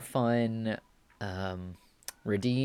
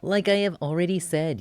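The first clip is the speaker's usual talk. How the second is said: put-on lightly raised cute boy voice; a hint of a bratty cute boy; cutie boy voice; 6 semitones higher, 3 dB louder than usual. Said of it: "give him a cutie voice".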